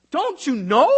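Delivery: loud, high rising disbelief; incredulous. Retold or imitated. Paul rebuking believers, loud high incredulous question. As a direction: demand it like they should know. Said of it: "in a very incredulous manner".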